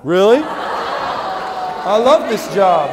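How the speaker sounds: laughing through the words, loud; amused protest, laughing out loud; laughing, loud defence of his job; laughing reply. Real, not acted. Laughing at a volunteer's jab.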